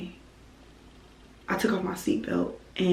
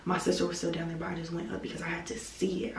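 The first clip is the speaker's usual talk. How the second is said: ordinary talk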